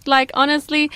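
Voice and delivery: usual speaking voice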